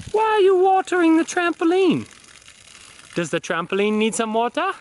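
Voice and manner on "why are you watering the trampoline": calling out. Real, not acted. Calling to child outside.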